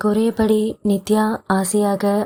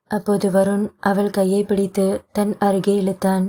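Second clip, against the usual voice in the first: ordinary delivery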